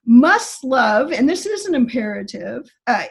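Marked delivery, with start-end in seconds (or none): 0.0-1.1 "must love" pushed out louder; emphatic command. Shown as a demonstration. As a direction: press "must" like a firm order. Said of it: "very emphatic".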